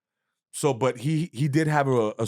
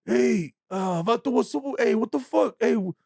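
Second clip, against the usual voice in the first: much higher, drawn-out mock tough-guy voice; imitating the angry boxer; 13 semitones higher, slower than usual. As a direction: stretch out Vato like an offended fighter. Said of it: "He's like".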